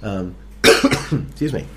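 hoarse, raspy from late nights. Real, not acted. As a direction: talk through a scratchy throat. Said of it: "I'm all raspy".

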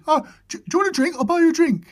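very high, eager offer; really high man's voice. Retold or imitated. Angler offering the raver a morning drink, high-voiced. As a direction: pitch it right up, keen and friendly. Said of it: "had this really high voice".

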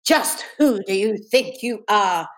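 slow, emphatic teacher's demand; understated bellow, drawn out; mild acted bellow. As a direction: punch out each word to put a pupil down. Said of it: "McHinton bellowed".

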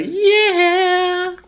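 held high squeaky note; high falsetto. Pretend. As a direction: stretch it up high and slow. Rating sung in falsetto.

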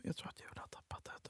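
breathless hushed whisper. Retold or imitated. Friend whispers to Jakob about Julia. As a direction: whisper it so she can't hear.

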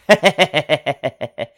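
rapid staccato chuckle; real laugh at a joke; amused chuckle. Real, not acted. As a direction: chuckle in a quick run of short bursts. Laughs at the boxing quote.

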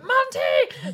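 name yelled way up high in exasperation; exasperated shout. Retold or imitated. Owner yelling at her cat from the stairs, high exasperated shout. Cat tipping fountain again.

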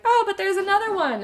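high, loud surprised gasp of a line; surprised parents. Retold or imitated. Parents discovering a second baby, surprised and high. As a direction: parents caught off guard, voice jumping up.

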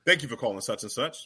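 code-switched work voice. Shown as a demonstration. Showing panel how he answers work calls, code-switch voice. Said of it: "Let's hear the code switch voice".

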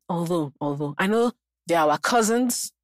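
ordinary talk, nothing marked

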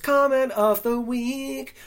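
high, loud, drawn-out fanfare; big slow build-up with amusement; mock-grand announcement. Real, not acted. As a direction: announce it like a prize winner, laughing. Announcing funniest comment in mock-grand style.

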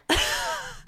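silent high-pitched laugh; high breathy laugh. Real, not acted. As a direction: laugh out under your breath. Laughing at the lyric.

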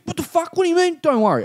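high, indignant mock challenge; imitated anger. Junkie rounding on the man beside him, mock anger. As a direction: pitch it way up as an offended man. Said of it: "he gets really angry".

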